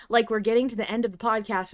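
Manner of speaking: ordinary talk, nothing marked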